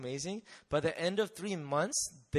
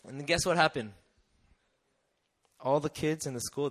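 ordinary talk, nothing marked

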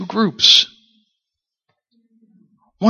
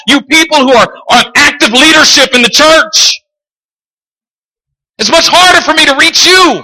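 high, loud preacher's climax; a pleading Jesus, preached loud and high; preaching at full force; 9 semitones higher, 6 dB louder than usual. Acted. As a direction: build it up and pound it out.

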